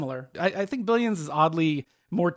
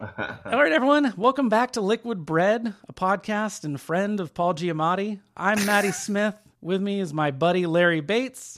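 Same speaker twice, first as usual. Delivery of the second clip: upbeat, higher host announcement; podcast host intro; 4 semitones higher than usual. Acted. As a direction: welcome listeners like a radio host.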